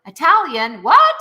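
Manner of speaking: high, loud double take, Italian then What; imitating kids who can't believe it; shocked students. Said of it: "And they're like".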